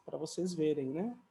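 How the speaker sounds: usual speaking voice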